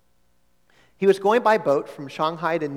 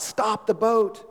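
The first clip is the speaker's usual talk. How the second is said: urgent call, higher and slow, to the boat crew; crying out; 6 semitones higher, slower than usual. Acted. Missionary crying out to the boatmen to stop. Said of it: "He cried out".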